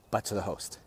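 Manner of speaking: ordinary talk, nothing marked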